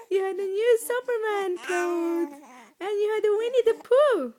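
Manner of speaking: high, cooing delight. Real, not acted. Parent admiring baby's new clothes, excited baby talk.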